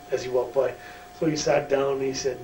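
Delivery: ordinary talk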